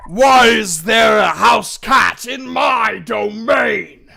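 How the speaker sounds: huge, slow, high screeching roar; very loud, drawn-out monster shout; roaring beast. Acted. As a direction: bellow like an outraged lord of the beasts. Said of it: "A lot of roaring".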